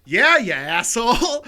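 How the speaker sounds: loud, slow, high mock retort. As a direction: snap back loudly, dragging it out. Teller's loud retort in story.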